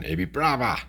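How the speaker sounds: light, badly faked Cockney London twang; bad Cockney accent. Joking about how Londoners say it, mock Cockney. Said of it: "worst Cockney accent ever".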